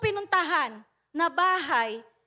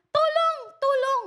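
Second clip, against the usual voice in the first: high, louder shout of help; shouted cry for help; 7 semitones higher, 3 dB louder than usual. Retold or imitated. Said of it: "Sumisigaw po siya".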